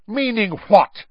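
high loud snap; snarled. A narrator's reading. Snape rounding on Moody, loud snarl. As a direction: snarl the question, sharp and loud. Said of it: "snarled Snape".

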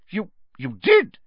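high, halting exclamation; stunned disbelief. Acted. Professor learns the knight let the man in, stunned. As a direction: stammer it, voice jumping up.

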